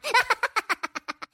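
high-pitched giggling burst; high giggle. Real, not acted. High laugh after rhyme.

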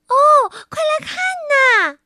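put-on little girl shouting to others to come look; little girl calling out. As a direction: squeal it like a thrilled little girl. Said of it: "小女孩喊道".

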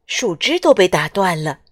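ordinary delivery

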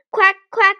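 high, loud duck quacks; duck quacking. Pretend. Duck introduces itself in lesson.

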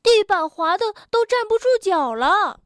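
high, alarmed complaint; alarmed character. A narrator's reading. Tiaotiao slips on the soapy floor, alarmed. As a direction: exclaim as if losing your footing.

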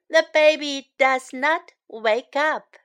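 normal talking voice

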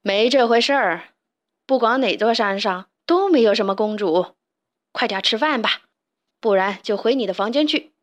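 loud, stern scolding dad; angry father. Acted. Father scolds son at dinner, loud and angry. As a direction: shut the dreams down firmly. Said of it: "爸爸生气了，大声说".

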